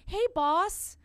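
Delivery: high, loud sheepish voice offering the tape; nervous audition pitch. Retold or imitated. Actor sending her audition to the boss, high and loud and sheepish. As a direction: play the eager underling handing over your tape.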